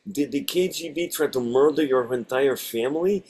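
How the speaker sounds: put-on lightly Russian-accented line; a hint of Russian accent. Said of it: "I get to do ... a Russian accent".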